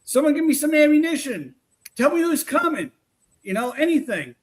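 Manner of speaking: high-pitched plea of a soldier under fire; acting in battle. Investigator acting out a war to draw spirits, high plea. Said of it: "I'd act like there's a war going on".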